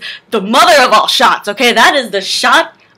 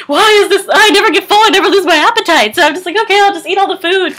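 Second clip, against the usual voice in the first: high, hammed-up wail; mock despairing whine; 9 semitones higher, 3 dB louder than usual. Overeater lamenting her endless appetite, mock whining. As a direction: whine it high like a helpless glutton.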